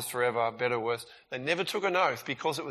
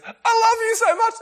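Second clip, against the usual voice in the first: high, loud, sugary declaration of love; sappy gushing Aaron; 12 dB louder than usual. Retold or imitated. Mock gushing friend telling you he loves you. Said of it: "right, in that voice".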